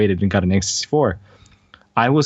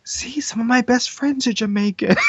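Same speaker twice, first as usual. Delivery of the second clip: high put-on defensive voice; mock excuse-maker; 12 semitones higher than usual. Co-host mocking the standard defence for an accent, in a high put-on voice. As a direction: parody someone excusing himself, voice pitched right up. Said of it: "the other route where it says".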